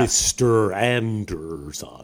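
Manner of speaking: slow, drawn-out movie villain impression; Agent Smith. Quoting the film villain to the panel, slow impression. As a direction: stretch each syllable in a put-on American accent. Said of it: "As Agent Smith, that weird American accent".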